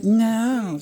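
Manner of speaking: high mock wife voice, flat refusal; imitating wife. Imitating his wife refusing a puppy, high and flat. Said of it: "she's like".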